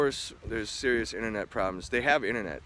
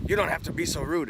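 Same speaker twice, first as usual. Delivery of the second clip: higher-pitched imitation of a yelling man; mock yelling; 6 semitones higher than usual. Restaurant staffer scolding a guest over rudeness, mock yelling. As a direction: retell his scolding, voice pitched up. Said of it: "he's yelling at me".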